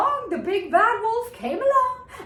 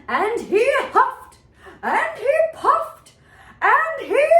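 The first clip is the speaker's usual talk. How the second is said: higher and louder, building; huffing build-up; 6 semitones higher, 6 dB louder than usual. A narrator's reading. Narrator builds the wolf's huffing for the children. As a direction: pump up the huffing and puffing.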